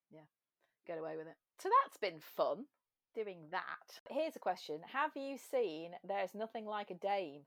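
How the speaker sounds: ordinary talk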